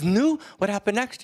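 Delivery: high, urgent Yiddish father; Yiddish accent. Acted. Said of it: "in a slight Yiddish accent".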